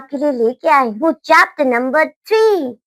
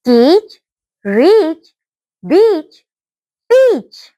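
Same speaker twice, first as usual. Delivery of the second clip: each word louder, climbing higher; repeat after me; 3 semitones higher, 3 dB louder, much slower than usual. Shown as a demonstration. Teacher modelling words for students to repeat, loud and clear. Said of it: "Repeat after me students".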